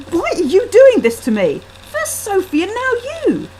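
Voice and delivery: high, loud wounded cry; distraught outburst. Acted. Husband accusing his wife of ganging up, loud and high. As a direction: lash out, feeling betrayed.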